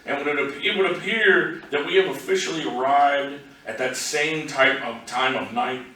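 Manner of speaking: projected spoken-verse delivery; poetry reading. Acted. Poet reads a road-trip poem to an audience, recital voice. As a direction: recite in measured phrases, lifted above casual talk.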